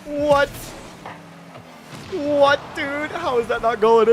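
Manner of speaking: high-pitched baffled protest; real disbelief, voice up high; incredulous. Real, not acted. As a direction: plead it in astonishment. Shot fails to go in.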